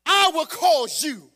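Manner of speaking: loud, rising preacher cadence, hammering each phrase; preaching at full force. Acted. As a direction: preach it to the back row.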